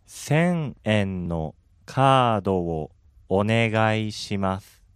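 slow, careful 千円のカードをお願いします; each syllable of 千円のカードをお願いします drawn out; over enunciating. Shown as a demonstration. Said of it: "One more time, slowly, please".

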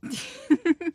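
squeaky high voice. Real, not acted.

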